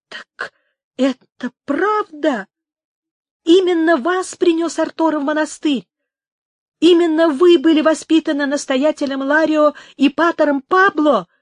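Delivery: breathless start swelling into high, loud questions; joyful disbelief, building in pitch and force; overcome with joy. A narrator's reading. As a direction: exclaim as if faint with happiness. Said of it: "голосом, замирающим от счастья и радости".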